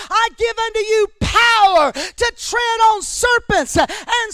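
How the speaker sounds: loud, driving sermon voice; shouting preacher in full flow; full-force preaching. Acted. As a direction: preach it hard to the congregation.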